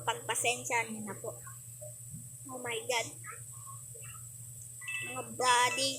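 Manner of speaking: ordinary talk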